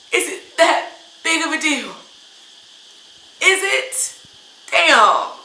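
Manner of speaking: exasperated rant ending in a loud damn; real upset. Real, not acted. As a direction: demand an answer, fed up. Upset rant about her skin colour.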